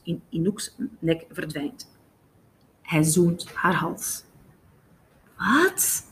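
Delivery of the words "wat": incredulous "what?", rising sharply; startled question. A narrator's reading. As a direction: blurt a disbelieving what. Jamie's startled question in story.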